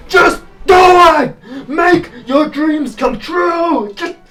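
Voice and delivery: very high, very loud, slow rallying yell; hammed-up pep-talk shouting; motivational shout. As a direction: scream encouragement at the audience.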